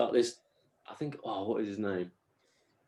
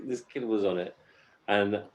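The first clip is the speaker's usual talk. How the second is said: ordinary talk, nothing marked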